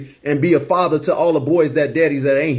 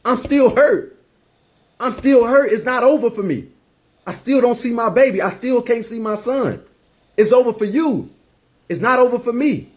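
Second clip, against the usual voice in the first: much higher, strained pleading of a crying mom; retold bawling mother; 7 semitones higher than usual. Son retelling his weeping mother's words on the phone, high and tearful. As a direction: voice his mother pouring out her hurt through tears. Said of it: "she's bawling, but she's speaking her truth".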